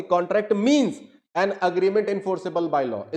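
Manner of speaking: slow read, "means" punched; stress on means. Shown as a demonstration. Teacher contrasting two readings for students, slow with "means" stressed. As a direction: read it slowly, hitting "means". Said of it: "मैं अगर कहता हूं कि".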